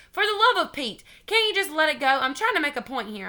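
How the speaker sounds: high, loud exasperated outburst. A narrator's reading. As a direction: throw your hands up with it. Junie exasperated at teacher.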